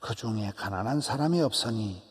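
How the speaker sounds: ordinary talk, nothing marked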